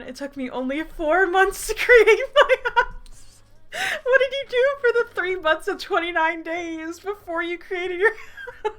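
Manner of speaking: high, squealing through laughter; incredulous laughter. Real, not acted. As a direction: lose it laughing while demanding an explanation. Incredulous laughing at own old story.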